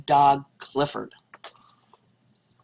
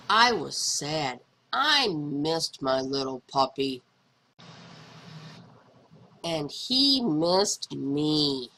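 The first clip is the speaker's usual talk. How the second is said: slow, sad and soft; 5 semitones lower, 3 dB quieter, slower than usual. A narrator's reading. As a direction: let the sadness slow it down. Missing the puppy.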